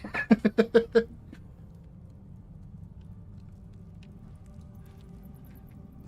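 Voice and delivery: breathy silent wheeze of laughter; wheezing laugh. Real, not acted. Streamer wheezing with laughter at herself.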